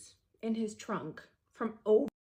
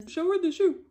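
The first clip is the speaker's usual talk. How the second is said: high, loud retold order; loud command; 9 semitones higher, 11 dB louder than usual. Boyfriend to the woman on the call, high loud order. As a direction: bark it at the phone. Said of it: "pans the camera and says".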